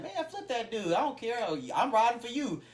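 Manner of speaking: high-pitched, worked-up show of support; fired-up loyal friend. Acted. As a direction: act out a friend jumping to take your side. Acting out a loyal friend's backing.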